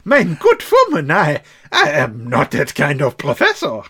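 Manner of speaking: hammed-up German protest; German accent. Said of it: "that German accent".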